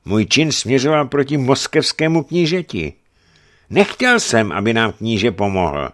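high, loud, impassioned justification; boyar's heated self-defence; fervent exclamation. A narrator's reading. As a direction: cry out your good intentions. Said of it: "zvolal bojar nároživně".

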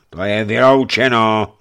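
disappointed exclamation; disappointed cry. A narrator's reading. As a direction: call it out, let down. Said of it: "zvolal Schönwald zklamaně".

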